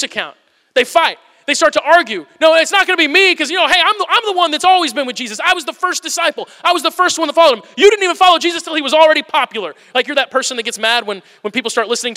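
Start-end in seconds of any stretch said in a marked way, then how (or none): 2.4-9.7 high, loud, heated squabble; a petty, indignant voice that keeps climbing; bickering disciple. Retold or imitated. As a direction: argue like a jealous rival. Disciples argue over greatness.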